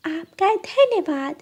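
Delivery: put-on high playful girlish voice; teasing little sister. Bride's sister teasing groom for shoe money, high and playful. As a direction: tease the groom cheekily.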